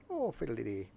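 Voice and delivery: a playful stage Irish exclamation; mock Irish accent. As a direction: toss it off in a joke Irish lilt. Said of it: "in an Irish accent".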